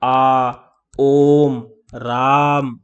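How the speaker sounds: drawn-out "आ", "ओम", "राम" with the vowel stretched; prolonged pluta vowels. Shown as a demonstration. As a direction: hold the "आ" of "राम" long like a call. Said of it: "आप यहाँ पे देखेंगे".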